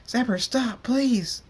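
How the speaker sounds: high, loud desperate plea; pleading scream. A narrator's reading. Fluttershy begs Zebra to stop, screaming. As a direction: scream it, begging. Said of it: "Fluttershy screams".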